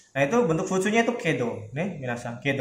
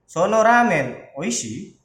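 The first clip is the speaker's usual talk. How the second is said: slow Sono ramen oishi? with the question carried by the tune; slow teaching demo of a casual question; 5 semitones higher, 5 dB louder, slower than usual. Shown as a demonstration. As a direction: ask Sono ramen oishi? using only intonation. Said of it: "Jadi pakainya nada".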